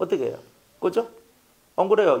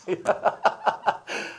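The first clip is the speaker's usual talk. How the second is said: breathy mocking laugh; 4 semitones higher than usual.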